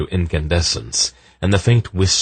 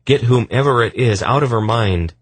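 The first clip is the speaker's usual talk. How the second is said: higher, insistent, urgent plea; pleading; 7 semitones higher, 4 dB louder than usual. Acted. Husband begs for help for his possessed wife, pleading. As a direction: beg for help. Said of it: "he pleaded".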